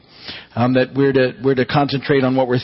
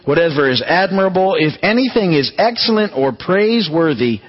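raised, measured reading voice; scripture reading; 3 semitones higher than usual. Acted. A pastor reads Philippians to his church, raised and measured. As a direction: read it out like a pastor.

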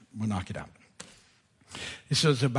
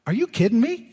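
incredulous, rising "are you kidding me"; mock disbelief at the driver; a touch of exasperation; exasperated thought; 7 semitones higher, 3 dB louder than usual. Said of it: "my thought was".

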